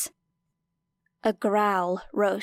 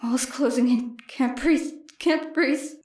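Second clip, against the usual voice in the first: panicking; 3 semitones higher than usual. A narrator's reading. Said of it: "Her throat closed".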